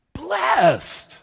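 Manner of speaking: BLESSED shouted on a high held note, then falling; high, ringing Blessed; emphatic exclamation. Shown as a demonstration. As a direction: cry out Blessed like an exclamation mark. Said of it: "They're meant to be emphatic".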